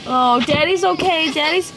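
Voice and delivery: high, loud sing-song reassurance. Real, not acted.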